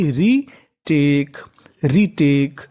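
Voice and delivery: Retake said very slowly, each part apart; teacher pacing it out slowly for beginners; slow teaching demo. Shown as a demonstration. Teacher to students, spelling a word slowly. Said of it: "तो meaning बनी टीए।".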